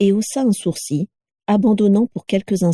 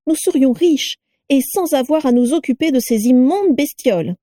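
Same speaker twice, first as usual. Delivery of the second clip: higher mocking wife's voice; mock the new parcel, voice pitched up; shrill sneering Carla; 7 semitones higher than usual. A narrator's reading.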